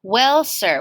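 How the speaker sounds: plain reading voice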